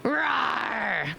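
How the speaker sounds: cute roar; a mock dinosaur growl; playful dino roar.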